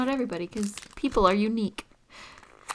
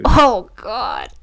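loud, appalled groan; real disgust; 11 dB louder than usual. Real, not acted. Taster reacts to salty licorice, loudly disgusted.

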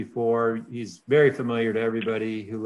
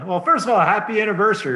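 excited; 10 semitones higher, 7 dB louder than usual. Real, not acted. Co-host greets anniversary excitedly.

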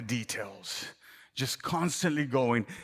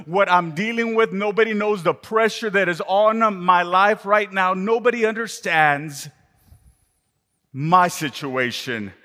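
loud, higher pleading complaint; frustrated inner monologue; 5 semitones higher, 5 dB louder than usual. Acted. Martha complaining to herself, loud frustrated rant. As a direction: pour out her stress like nobody listens. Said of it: "internal monologue in her own self".

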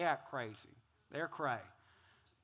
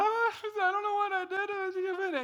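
high-pitched fake crying whine; mock tearful; 14 semitones higher, 7 dB louder than usual. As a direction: wail it like a hammed-up victim. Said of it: "they're in tears and they're like".

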